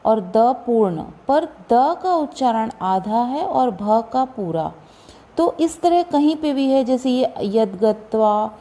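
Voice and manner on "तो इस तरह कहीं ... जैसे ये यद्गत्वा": ordinary talk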